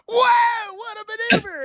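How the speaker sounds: over-the-top play-by-play shout; yelling commentary. Retold or imitated. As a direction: yell the call like a wrestling announcer. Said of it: "yelling in the room".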